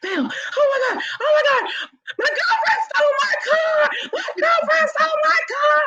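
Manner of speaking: frantic high yelling caller, out of breath; hammed-up hysterical man shouting about his stolen car; panicked caller. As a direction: play the caller screaming in panic. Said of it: "he was huffing and puffing".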